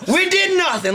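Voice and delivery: high, proud declaration; hammed-up triumph; mock proud cheer. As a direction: boast like a job well done. Mocking the hunters' pride.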